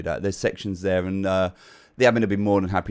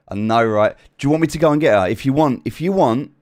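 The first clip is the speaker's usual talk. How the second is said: ordinary delivery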